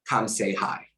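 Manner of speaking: usual speaking voice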